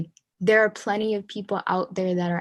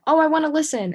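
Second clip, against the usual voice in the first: mock eager listener; 8 semitones higher, 5 dB louder, faster than usual. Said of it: "don't sit there and be like".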